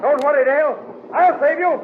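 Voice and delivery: very high, loud reassurance; heroic shout. Acted. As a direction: call out to her across the chaos. Flash shouts over the collapse.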